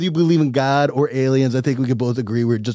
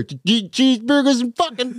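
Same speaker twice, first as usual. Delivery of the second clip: high, slow scornful God voice; a disappointed deity sneering at humans; mock God; 10 semitones higher than usual.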